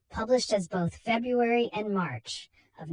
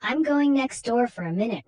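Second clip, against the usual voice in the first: louder, higher call across the room; 5 semitones higher, 7 dB louder than usual. Acted. Husband calls out leaving.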